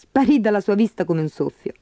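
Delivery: plain reading voice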